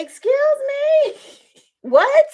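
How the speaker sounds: high, slow, stunned excuse me with a laugh; can't believe what she just heard; shocked disbelief. Retold or imitated. Mom told to walk to the OR, shocked high disbelief.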